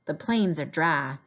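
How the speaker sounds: plain reading voice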